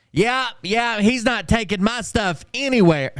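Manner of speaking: high, whiny mock of the young mechanic; high nasal-free mock voice, indignant; mocking kid. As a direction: lampoon the self-important nineteen-year-old.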